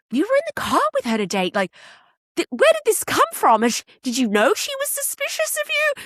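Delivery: rising disbelief. Retold or imitated. Shushi questioning Nori about the car ride, rising fast disbelief. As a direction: pile on the questions, pitch going up. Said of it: "And Shushi's like".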